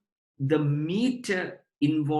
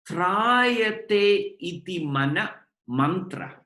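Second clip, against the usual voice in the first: trayate iti mana mantra, each word drawn out slowly; slow recitation; 2 semitones higher, slower than usual. Shown as a demonstration. Teacher to students defining mantra, slow recitation. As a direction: lay out the Sanskrit words slowly, one by one.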